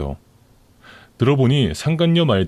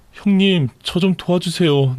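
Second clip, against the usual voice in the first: higher, tearful-sounding plea from the mistress; pleading mistress; 6 semitones higher, slower than usual. Acted. Mistress begging her lover's sister for help, pleading.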